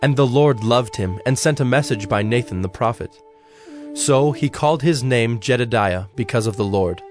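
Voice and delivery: normal talking voice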